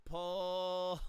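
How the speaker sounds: very slow, drawn-out coaching cues; slow drill cues. Shown as a demonstration. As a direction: stretch each cue out like a slow demo. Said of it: "So you do that with the left arm".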